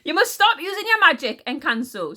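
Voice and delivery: high, loud queenly order; angry demand. A narrator's reading. Queen ordering Encanso to stop magic, angrily.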